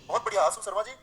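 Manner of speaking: ordinary talk, nothing marked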